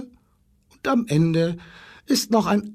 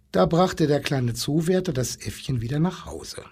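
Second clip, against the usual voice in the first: ordinary delivery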